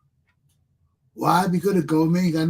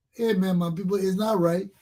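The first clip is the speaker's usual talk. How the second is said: ordinary delivery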